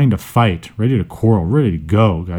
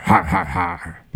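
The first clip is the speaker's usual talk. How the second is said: mock villain laugh; 5 semitones lower, 6 dB quieter, slower than usual. Spooky laugh after eerie line.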